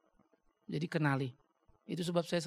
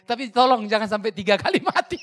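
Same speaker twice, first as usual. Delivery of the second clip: laughing warning; 4 semitones higher, 11 dB louder than usual. Retold or imitated. Joking warning to the con man.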